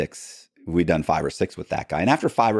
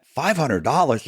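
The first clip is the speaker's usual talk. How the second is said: higher, slow incredulous echo; stunned disbelief; 8 semitones higher than usual. Retold or imitated. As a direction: repeat the price like you can't believe it. Stunned at tiny fee.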